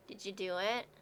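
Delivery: hushed seductive murmur, low and soft; sexy voice. Acted. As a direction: breathe it out seductively. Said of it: "I'm trying to do my sexy voice for John".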